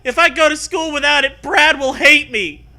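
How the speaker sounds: high, loud panicked plea; panicky. Acted. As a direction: blurt it out in a panic. Acted panicky quote.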